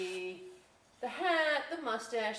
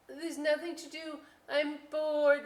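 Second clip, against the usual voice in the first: put-on higher childish whine; whiny child; 8 semitones higher, 5 dB louder than usual. Child whining to mother, lightly voiced higher whine. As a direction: whine it like a kid to mom. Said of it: "I used to say to my mother".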